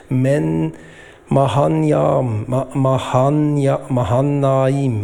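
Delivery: Mahanaim slow and drawn out, with false starts; sounding out haltingly. Real, not acted. Preacher attempting a hard place name, sounding out haltingly. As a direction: feel your way through Mahanaim syllable by syllable.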